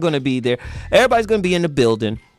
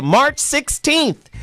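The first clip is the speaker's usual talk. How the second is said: much higher, slow stretched date; hyped date; 12 semitones higher, much slower than usual. Real, not acted. Host plugging his mixer to listeners, high stretched announcement. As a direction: sell the date like a promo. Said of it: "announcing the event date".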